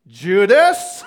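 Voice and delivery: very loud call with rising question; mock loud call. Jesus calling Judas over to take the bread, mock loud call. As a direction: call the name out suddenly, after all the whispering.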